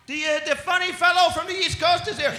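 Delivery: high, loud secretary voice; hammed-up receptionist shouting through; mock secretary announcing. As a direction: announce a visitor at a high pitch. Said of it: "And they go".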